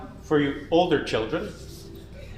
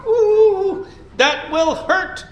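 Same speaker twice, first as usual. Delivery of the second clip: high ooh then slow that will hurt; worried ooh; 13 semitones higher, 5 dB louder, much slower than usual. Acted. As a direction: wince in advance at the pain. Fears forgotten splinter.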